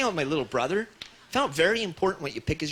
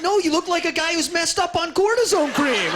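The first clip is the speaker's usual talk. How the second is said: high, loud mock outrage; exasperated retort; 13 semitones higher, 6 dB louder than usual. Nephew answers drunk uncle at roadblock, high exasperated shout. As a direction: yell it back in hammed-up exasperation.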